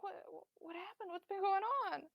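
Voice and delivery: put-on high, soft, anxious old mother; worried frail mother. Frail mother asking her son what happened, high and soft. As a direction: ask gently, fearing bad news.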